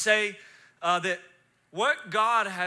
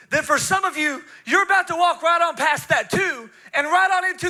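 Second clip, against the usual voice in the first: loud, high, climbing to a shout; impassioned prophetic preaching; 9 semitones higher, 10 dB louder than usual. Real, not acted. Preacher prophesying over the congregation's lives, impassioned and shouting. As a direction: drive it up to a rallying cry.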